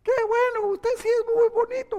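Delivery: very high-pitched cartoon toy; tiny squeaky flatterer; Toy Story toy voice. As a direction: squeak it out like a little toy creature. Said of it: "esos son los de Toy Story".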